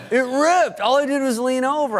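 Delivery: high, loud innocent Farley voice; Chris Farley excuse. Retold or imitated. Farley explaining the torn jacket in a high loud voice. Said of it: "he goes".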